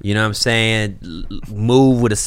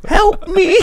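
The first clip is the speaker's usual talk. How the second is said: mock plea. Mock abuse victim after bruises.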